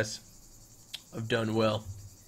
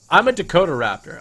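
proud announcement; 5 semitones higher, 9 dB louder than usual. Pretend. Proud dino roleplay announcement.

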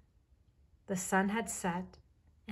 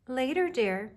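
higher, louder motherly call; mild shout back; 6 semitones higher, 6 dB louder than usual. A narrator's reading. Mother Bear answers her cub over the waterfall, mild shout. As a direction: call back over noise, lightly raised. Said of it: "Mother Bear shouted back".

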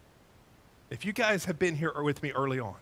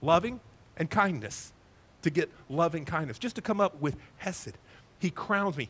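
ordinary talk, nothing marked

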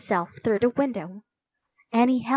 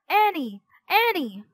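high-pitched urgent call; a mild, high shout; 8 semitones higher than usual. A narrator's reading. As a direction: call out her name, pitched well up. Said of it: "he shouted, panting".

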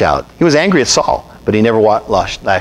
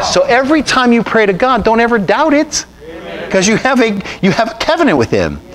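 much higher, driving preacher's cry; impassioned preaching; 9 semitones higher than usual. Acted. Preacher urging the congregation to trust, impassioned. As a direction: lift the congregation, don't let them doubt.

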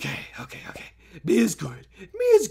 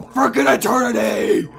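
excited shout; 4 semitones higher, 6 dB louder than usual. Pretend. Exaggerates love of the game.

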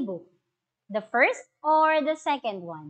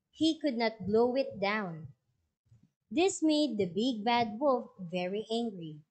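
story narration; 5 dB quieter than usual. A narrator's reading. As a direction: clear storybook reading to a class. Teacher reads story aloud.

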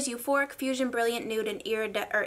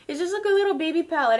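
higher, louder and fast, gushing; excited gush; 7 semitones higher, 6 dB louder, faster than usual. Real, not acted. Vlogger gushing to viewers over a tiny palette, excited. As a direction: rush it out in delight.

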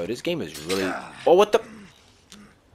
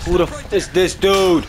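high, loud who the f; louder and higher, alarmed; shocked outburst; 8 semitones higher, 8 dB louder than usual. Real, not acted. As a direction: blurt it in alarm. Shocked by a new attacker.

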